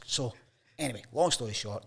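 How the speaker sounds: usual speaking voice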